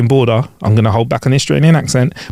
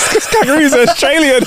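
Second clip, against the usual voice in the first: laughing remark; 12 semitones higher than usual. Real, not acted.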